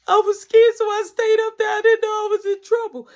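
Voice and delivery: high, loud whiny plea; a frightened boy begging his mother; scared pleading. Retold or imitated. Mark pleading with mama.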